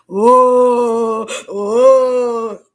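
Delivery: overdone wail of protest; mock fussing. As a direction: ham up a whiny complaint. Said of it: "don't go acting like".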